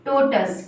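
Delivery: "टोटस" with a short us ending; correct tortoise. Shown as a demonstration. Teacher drilling learners, toh-tus. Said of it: "क्या बोलेंगे?".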